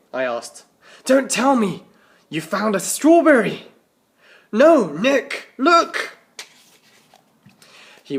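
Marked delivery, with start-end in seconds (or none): 1.1-3.7 excited teasing. Acted. Narrator voices teasing Nick. 4.5-6.2 put-on higher, louder boyish voice, bursting with news; excited Tim. Tim shows Nick the yogurt foil, excited higher voice.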